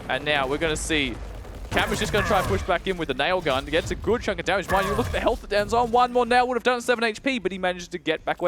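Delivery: high, loud rapid play-by-play; esports caster. Real, not acted. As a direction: keep the hype rolling. Caster calls the duel live.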